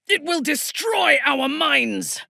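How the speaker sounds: high, slow pained cry under mental pressure; pained shout. Acted. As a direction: cry out as your mind is crushed. Psionic pressure, crying out in pain.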